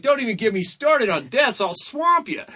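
boastful one-upping. Acted. Acted lobbyists one-upping each other.

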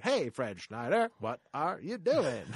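slow, drawn-out sing-song call; a light camp chant of the name. Retold or imitated. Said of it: "everyone had to talk like [name]".

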